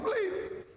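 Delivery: a single high-pitched mock alarm bleep; quick high bleep imitating a flagged bank check; imitated bleep. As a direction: squeak it out like a warning signal.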